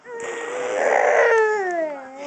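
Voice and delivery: high, delighted burst of real laughter. Real, not acted. Mom cracking up at her baby, delighted laugh.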